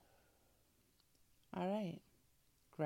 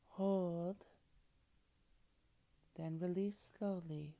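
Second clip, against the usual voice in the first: guided breathing. Acted. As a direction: calmly guide each breath. Leads breathing exercise.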